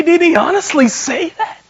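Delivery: high-pitched, astonished question; acted incredulity. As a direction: query it in mock shock. Voices disbelief beside his wife.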